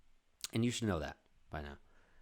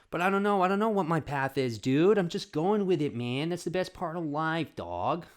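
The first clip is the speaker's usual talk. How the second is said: loud; 7 semitones higher, 7 dB louder than usual. Real, not acted. Excited life musing.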